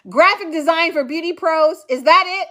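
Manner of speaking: ordinary talk, nothing marked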